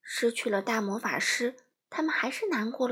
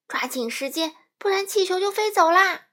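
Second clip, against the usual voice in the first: louder, higher warning call; urgent shout; 7 semitones higher, 6 dB louder than usual. A narrator's reading. Wizard urges Dorothy to hurry, loud and urgent. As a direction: hurry her before the balloon flies off. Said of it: "魔法师喊道".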